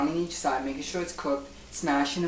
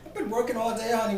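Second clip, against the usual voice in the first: whiny; 12 semitones higher than usual. Acted. Whiny hungry complaint, high pitch.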